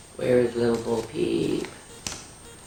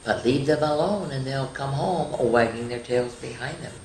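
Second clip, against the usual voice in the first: nursery rhyme recital. Acted. Reads nursery rhyme aloud.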